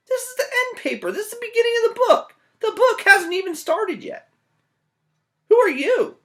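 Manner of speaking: very high, loud, fast panic; high frantic squeal; frantic Jack. Acted. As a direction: squeal in alarm that the book hasn't started.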